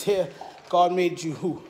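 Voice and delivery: ordinary talk, nothing marked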